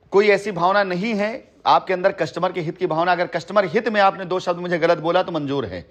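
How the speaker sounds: ordinary talk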